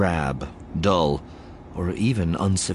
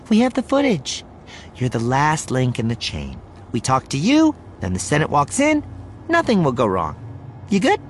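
higher-pitched, assured TV host voice; brisk, reassuring broadcaster character; confident reporter figure; 7 semitones higher than usual. Acted. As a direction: settle a jittery guest with firm confidence. Voices reporter calming her guest.

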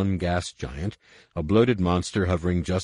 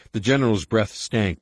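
plain reading voice

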